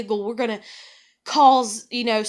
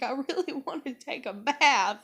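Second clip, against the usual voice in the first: whiny plea; 6 semitones higher than usual. Retold or imitated. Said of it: "And I'm like".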